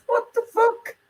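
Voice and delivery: retold disbelief. Caller retelling his reaction to the copper, mock shocked squeak. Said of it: "I'm like".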